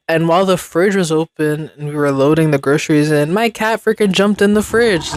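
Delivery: usual speaking voice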